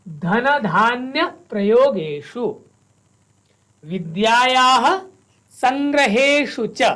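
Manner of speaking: each word of the verse said slowly and carefully apart. Shown as a demonstration. Teacher models each word for students, slow and careful. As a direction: pronounce every word separately, slowly. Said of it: "एकैकस्य पदस्य उच्चारणं कुर्मः।".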